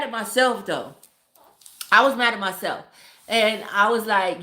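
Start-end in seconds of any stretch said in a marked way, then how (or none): none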